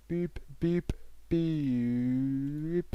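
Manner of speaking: high boops, the last drawn out; imitated half-time whistle. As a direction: boop like a referee's final whistle.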